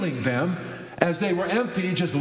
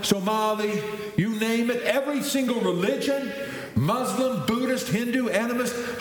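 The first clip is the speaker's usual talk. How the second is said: each name pitched higher, driving the list up; rising preaching; 6 semitones higher than usual. Real, not acted. Pastor rallying the congregation, building higher in pitch. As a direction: preach it, climbing with every group.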